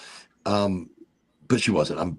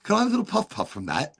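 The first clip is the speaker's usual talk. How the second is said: cheeky British cop; 8 semitones higher than usual. Retold or imitated. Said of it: "He goes".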